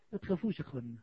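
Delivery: soft, low and soothing; gentle reassurance. Real, not acted. Preacher calming the congregation about the grave, gentle reassurance. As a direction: bring the volume right down to comfort.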